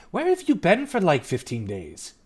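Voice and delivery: exasperated father. Acted. Father questioning missing daughter.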